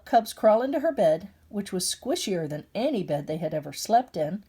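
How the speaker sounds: plain reading voice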